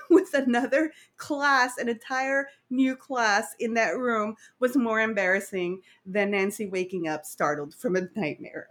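usual speaking voice

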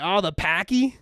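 loud, high mock Boston accent; Boston manager. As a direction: call it out like a Boston local. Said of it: "he was from Boston".

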